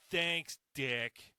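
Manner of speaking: loud, sarcastic thanks; sarcastic shout. Acted. Homeowner yelling sarcastic thanks at plow truck driver.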